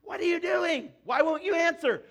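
high, loud angry-text shout; mock yelling. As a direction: yell it like an all-caps text. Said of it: "like you're yelling at them".

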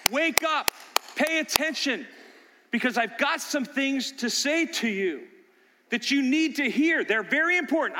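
high, loud call to wake them up; urgent fatherly call. Acted. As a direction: rouse your sons, insistent. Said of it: "what Solomon is saying to his sons".

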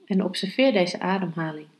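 calm, hushed meditation guidance. Acted. Leads a meditation, breath focus. (zachte, rustige meditatiestem)

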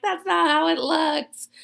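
high, loud, slow exasperated wail; mock frustration. Arguing with grandpa's instructions, mock frustrated. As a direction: protest slowly and loudly, hammed up. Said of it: "I was just frustrated".

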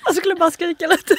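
high, giggly delivery; amused, high-pitched laughing talk. Real, not acted. Both joke laughing about screaming.

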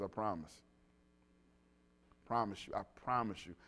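sincere promise. Real, not acted. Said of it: "preacher's earnest promise".

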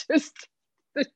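ordinary talk

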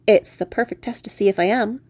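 ordinary delivery, nothing marked

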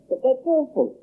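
put-on high, appalled child's outcry; shocked amazement. A narrator's reading. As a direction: recoil at the idea, pitch soaring. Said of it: "tone of shocked amazement".

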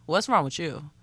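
ordinary talk

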